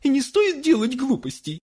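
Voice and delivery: high-pitched mother voice; high female character voice; mother's scolding. A narrator's reading.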